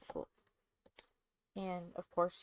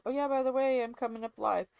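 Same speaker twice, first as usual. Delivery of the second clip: louder, higher teasing announcement; spring the news like a surprise; mock announcement; 5 semitones higher, 7 dB louder, faster than usual.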